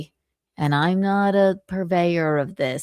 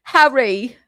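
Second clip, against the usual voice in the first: mock British accent; 8 semitones higher, 4 dB louder than usual. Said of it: "a British accent".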